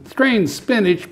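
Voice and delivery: disgusted pirate echo. Acted. Pirate captain echoing the boy in high mock disgust. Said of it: "Braidbeard said".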